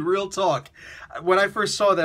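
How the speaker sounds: ordinary talk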